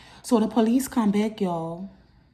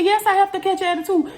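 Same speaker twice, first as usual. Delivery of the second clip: high-pitched indignant sass; attitude; 11 semitones higher, 4 dB louder than usual. Retold or imitated. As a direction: talk back sharply, voice pitched way up. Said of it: "You don't have to catch an attitude".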